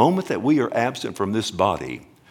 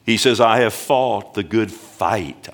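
"I have fought the good fight" said slowly and louder; emphatic quote; 4 dB louder, slower than usual. Real, not acted. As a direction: lean into "fight", slow and weighty. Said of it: "I love that word, fight".

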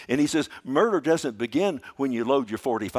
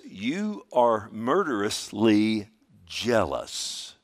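each word drawn out slow and weighty; slow emphatic reading; much slower than usual. Shown as a demonstration. Pastor reads scripture to congregation, slow and weighty. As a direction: lean on murderously jealous, slowly. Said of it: "that's a good word".

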